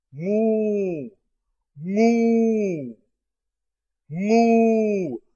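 mou, nasal from the throat, held long; nasal syllable mou. Shown as a demonstration. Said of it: "Son nasal qui vient de la gorge".

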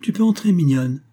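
high-pitched call to someone outside. A narrator's reading. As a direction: call the child in, voice lifted high. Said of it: "et elle cria".

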